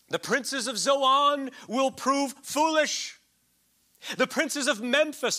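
slow, loud, high proclamation of the verse; preaching scripture. Acted. Preacher reading Isaiah's rebuke to his congregation, slow and loud. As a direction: declaim each word like a prophet.

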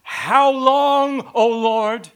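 desperate lament shouted upward; crying out to God. Acted. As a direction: wail it up to heaven. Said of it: "cries out to God".

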